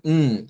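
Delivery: n hummed alone through the nose; nasal n. Shown as a demonstration. Teacher presenting alveolar sounds, nasal hummed n. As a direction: hum the sound n, no vowel. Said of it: "es nasal".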